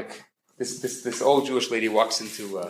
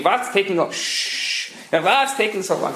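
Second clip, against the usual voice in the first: mock impatient yell; 6 semitones higher than usual.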